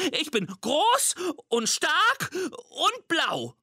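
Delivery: squeaky monster boast, slow and high; Mimi. A narrator's reading. Little monster claiming to be someone bigger, high squeaky boast. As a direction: boast slowly in a tiny piping voice. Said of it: "ängstlichen Monster mit Piepsstimme".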